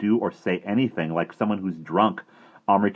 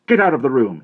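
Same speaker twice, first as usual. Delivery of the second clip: loud angry roar; 8 semitones higher, 12 dB louder than usual. A narrator's reading. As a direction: order him out furiously. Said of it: "he roared".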